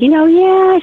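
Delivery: breezy midwife brushing it off; imitating calm midwife. Midwife reassuring first-time mom, lilting and unworried. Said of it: "She was very calm".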